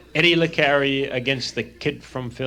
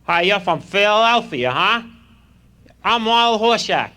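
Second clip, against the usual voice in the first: put-on high, loud nasal-free goofy kid voice, much higher; Horshack voice; 7 semitones higher, 4 dB louder than usual. Horshack taunts the new kid, high and loud. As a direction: ham up the schoolyard tough kid.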